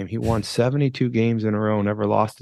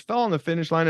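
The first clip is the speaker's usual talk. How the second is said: usual speaking voice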